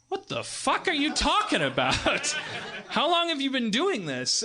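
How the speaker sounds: high-pitched, bewildered mock outrage; incredulous outburst. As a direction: act out stunned disbelief, voice shooting way up. Bewildered activists confront older interviewee.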